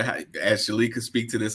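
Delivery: usual speaking voice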